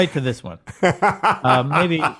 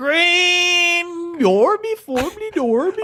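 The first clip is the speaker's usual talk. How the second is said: high, slow sing-song mock Swedish; silly Swedish Chef impression to tease a friend; 13 semitones higher, much slower than usual. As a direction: bounce the words like the Swedish Chef. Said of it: "The Swedish Chef is mainly what I was doing".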